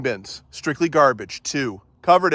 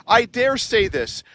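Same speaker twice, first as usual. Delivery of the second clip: ordinary delivery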